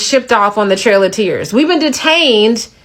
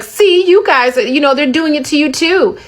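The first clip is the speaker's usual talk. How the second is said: higher-pitched imitation of a gloater; put-on higher mocking voice; mock gloating; 6 semitones higher than usual. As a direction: mimic an I-told-you-so taunt, pitched up. Mimics gloating people in argument.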